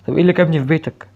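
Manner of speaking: loud, high shouted question. Real, not acted. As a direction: yell it in disbelief. Same question shouted then repeated. (سؤال بصوت عالٍ)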